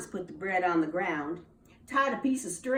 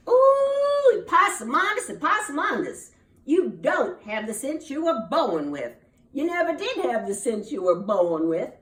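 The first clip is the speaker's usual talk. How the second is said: scolding mama; 3 semitones higher, 5 dB louder than usual. Acted. Mama scolds the foolish son.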